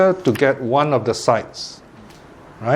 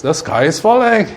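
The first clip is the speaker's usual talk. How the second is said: raised-pitch quote of a worried rabbit; higher, mildly alarmed storytelling quote; mock alarm; 6 semitones higher than usual. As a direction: lightly act out a rabbit's alarm. Rabbit crying that the sky falls.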